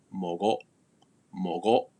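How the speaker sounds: mogo cut off in the throat; "mogo" ending abruptly, glottis shut; glottal stop. Shown as a demonstration. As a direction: chop the end of mogo with a glottal catch. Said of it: "that glottis is cut off".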